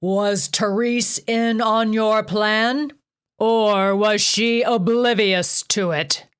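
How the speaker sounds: 高く大きくゆっくり叫ぶ; 詰問するように怒鳴る; shout. A narrator's reading. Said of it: "Zeus shouted".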